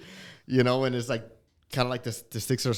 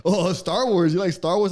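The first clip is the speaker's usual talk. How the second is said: louder, higher mock-friendly cop; pitched-up imitation of a chummy officer; chatty cop; 6 semitones higher, 6 dB louder than usual. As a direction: play the cop trying to make friends. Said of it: "And he goes".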